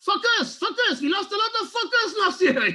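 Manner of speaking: high, loud French cry; French accent realization. Retold or imitated. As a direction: let the French manager's slip dawn on him. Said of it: "he suddenly realizes".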